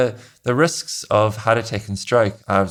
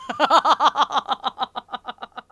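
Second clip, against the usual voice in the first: real laugh. Real, not acted. Laughing at accent joke.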